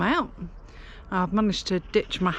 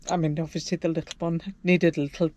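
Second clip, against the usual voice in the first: ordinary delivery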